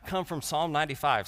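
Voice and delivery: ordinary delivery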